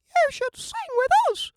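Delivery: very high, fast chirpy voice; birds inviting. Acted. Birds inviting Jim to sing, very high and fast. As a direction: chirp it at him. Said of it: "said the birds".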